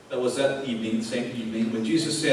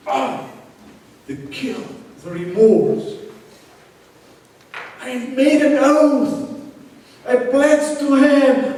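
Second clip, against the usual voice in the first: higher, louder cry of guilt; anguished remorse; 12 semitones higher, 11 dB louder, slower than usual. Acted. Peter lamenting his broken oath, higher and louder in anguish. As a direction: wail it out as if the guilt is crushing you.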